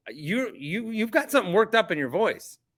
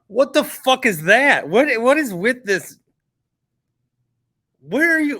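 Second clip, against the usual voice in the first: loud, higher, disbelieving what the fuck; outraged outburst; 5 semitones higher, 5 dB louder than usual. Real, not acted. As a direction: blurt it out, stunned and angry. Outraged at insult to his team.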